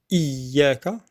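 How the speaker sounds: slow Iyyaka with a long i-y before yaa; stressed double ya. Shown as a demonstration. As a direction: press and hold the yy in Iyyaka. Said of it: "l'effort de bien prononcer le ya mushaddada".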